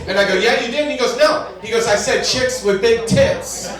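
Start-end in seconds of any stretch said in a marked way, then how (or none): 0.3-0.8 high, quick contradiction; mock insistence. Acted argument with friend. 1.2-1.5 high, loud comic no; mock emphatic denial. Acted argument with friend. 1.9-3.7 "chicks with big tits" slow and high; emphatic correction. Retold or imitated. Friend corrects the misheard phrase.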